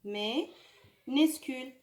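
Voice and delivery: "Minuscule" drawn out syllable by syllable; very slow model word. Shown as a demonstration. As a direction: stretch "Minuscule" out slowly. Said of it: "c'était en minuscule". (نطق بطيء جدًا لكلمة Minuscule)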